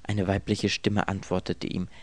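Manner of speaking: usual speaking voice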